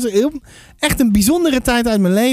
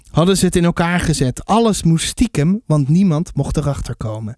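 lower-pitched storybook reading voice; story narration; 5 semitones lower than usual. A narrator's reading. Author reads a little piece of the book to listeners, story narration. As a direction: read the chapter out as a storyteller.

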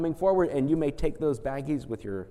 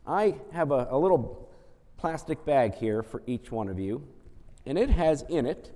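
ordinary delivery

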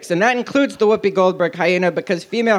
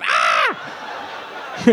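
drawn-out comic "Ah!" scream; acted scream of agony; mock scream; 4 dB quieter than usual. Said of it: "Aladdin screamed".

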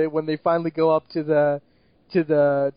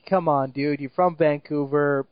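ordinary talk

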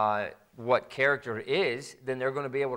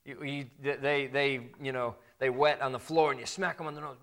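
ordinary talk

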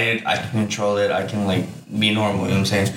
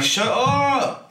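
squealed-up mock exasperation, very high; comic fed-up whine, stretched out; mock pissed-off; 12 semitones higher than usual. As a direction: escalate the bickering in a much higher pitch. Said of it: "I'll get pissed".

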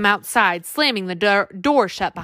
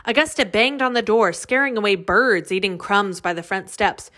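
plain reading voice

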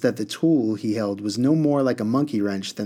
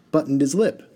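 plain reading voice

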